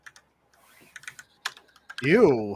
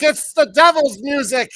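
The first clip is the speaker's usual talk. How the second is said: outraged preacher voice; 8 semitones higher, 7 dB louder than usual. Retold or imitated. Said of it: "he's like".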